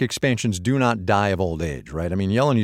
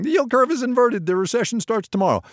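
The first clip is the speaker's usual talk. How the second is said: headline read in a much higher voice; mock headline alarm, much higher; mock alarmist headline; 13 semitones higher than usual. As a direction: pitch it up like a breathless doom headline. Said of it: "If you see a headline saying".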